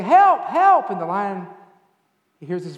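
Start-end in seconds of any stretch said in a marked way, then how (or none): 0.0-0.9 much higher, very loud repeated cries; yelling for help. Acted. Fake gorilla as the lion comes closer, yelling. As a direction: yell help twice, high and frantic. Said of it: "he's yelling".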